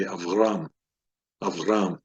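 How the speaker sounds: "Avram" as av-RAM, weight on the end; stress on last syllable. Shown as a demonstration. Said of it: "last syllable".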